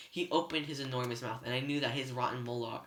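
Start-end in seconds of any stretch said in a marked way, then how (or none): none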